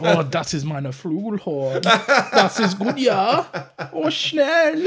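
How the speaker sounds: slow, high-pitched hammed-up German; mock German porn actor. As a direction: moan it out in exaggerated German. Mock German porn film dialogue.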